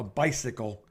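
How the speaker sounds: usual speaking voice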